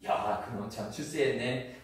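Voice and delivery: normal talking voice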